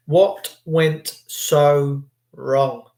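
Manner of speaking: very slow, "what" stressed; drawn-out grave repeat of the question. Shown as a demonstration. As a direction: let each word fall slowly.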